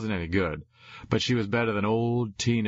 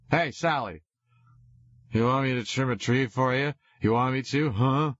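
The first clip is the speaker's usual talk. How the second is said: put-on drunken shouting; 5 semitones higher than usual. A narrator's reading. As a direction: beg like a drunk.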